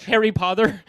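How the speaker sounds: "Harry Potter" with soft lisped t; slushy lisp. Shown as a demonstration. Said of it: "Like a soft T".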